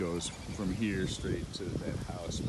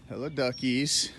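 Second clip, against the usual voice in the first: bright, cooing greeting to pets; talking to ducks; 5 semitones higher, 5 dB louder than usual. Real, not acted. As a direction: coo hello to the ducks like babies.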